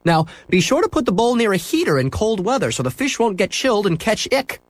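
high, friendly shopkeeper; cheerful shopkeeper agreeing. A narrator's reading. Shopkeeper to boy in pet store, high and cheerful.

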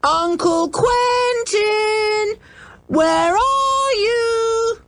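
high, slow, drawn-out calls across the ruins; calling out loudly. Acted. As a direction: call far across the castle yard. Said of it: "They shouted again".

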